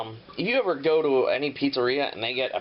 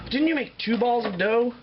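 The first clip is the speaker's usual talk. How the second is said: mock viewer question; 8 semitones higher than usual. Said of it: "Now at this point you might say".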